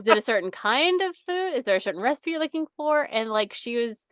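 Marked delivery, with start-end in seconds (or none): 0.0-3.1 mock helpful coworker. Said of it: "they're like".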